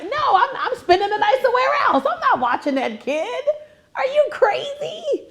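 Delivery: high, incredulous comic refusal; mock outrage. Grandma refusing her son's babysitting request, mock outrage.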